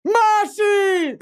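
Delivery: high shout of a name; loud, high call. Pretend. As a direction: call her across the room. Calling out a name.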